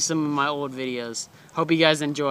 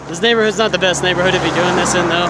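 ordinary delivery

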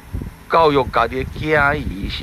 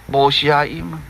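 plain reading voice

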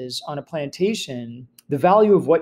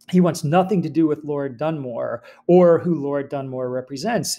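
ordinary talk

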